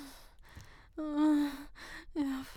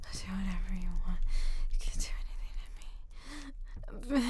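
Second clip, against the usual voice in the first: fast urgent whisper; 2 semitones lower, 8 dB louder, much faster than usual. Real, not acted. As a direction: whisper it in a rush.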